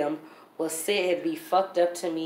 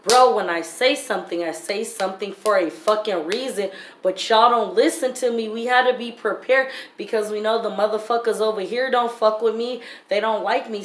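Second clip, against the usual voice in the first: louder, higher, heated lecture; retold angry scolding; 4 semitones higher, 5 dB louder than usual. Mother retelling how she scolded her attorney, loud and heated. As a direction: give your lawyer a furious dressing-down. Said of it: "And I told him".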